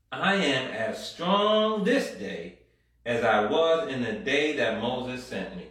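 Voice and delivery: ordinary delivery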